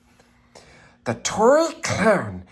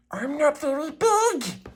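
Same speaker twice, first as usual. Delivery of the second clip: high, timid little engine; small engine voice; 14 semitones higher than usual. A narrator's reading. Little blue engine answers the toys, high and shy. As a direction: shyly admit you're small.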